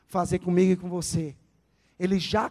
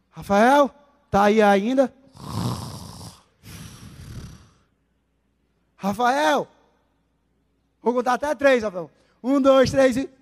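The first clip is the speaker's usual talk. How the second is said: louder calling out a name; 5 dB louder than usual. Retold or imitated. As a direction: call to someone who dozed off. God calling the sleeping believer.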